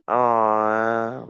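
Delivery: normal talking voice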